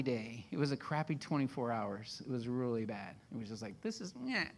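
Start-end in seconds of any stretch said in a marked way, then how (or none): 3.8-4.4 a small, soft, higher grumble; whining. Retold or imitated. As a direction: grumble a weary little "meh". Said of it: "You should have heard me whine".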